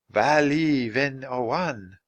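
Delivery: slow foreign-sounding maiden voice; strange language. A narrator's reading. Swan Maiden addressing her brother in a strange language. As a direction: gently speak the old tongue. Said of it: "spoke in a strange language".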